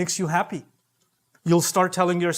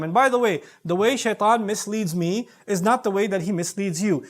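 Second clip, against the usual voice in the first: ordinary talk, nothing marked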